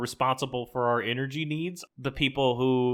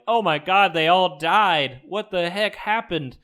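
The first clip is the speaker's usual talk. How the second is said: louder and higher, slow horrified gasp at the bodies; shocked; 6 semitones higher, 6 dB louder, slower than usual. Retold or imitated. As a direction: act stunned by the death scene. Tribe finds the fake bodies.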